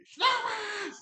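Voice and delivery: loud call across the house; shouting a name. Real, not acted. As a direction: yell the name to call him over. Calling out to the dog.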